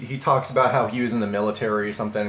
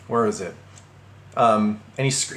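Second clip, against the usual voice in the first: plain reading voice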